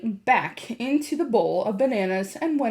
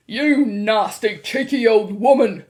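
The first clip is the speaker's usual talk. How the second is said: loud, drawn-out outrage; shouted; 9 dB louder than usual. A narrator's reading. Mr. Jenkins rages at the grandmother, shouting. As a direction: bellow the insult slowly. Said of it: "shouted Mister Jenkins".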